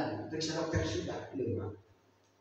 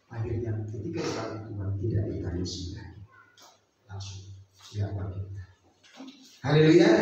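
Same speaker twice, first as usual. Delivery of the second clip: usual speaking voice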